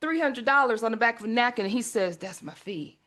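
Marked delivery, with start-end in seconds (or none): 2.2-3.0 low, very quiet deadpan dad; matter-of-fact dad. Retold or imitated. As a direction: drop the voice, state it coolly. Dad coolly names his fee.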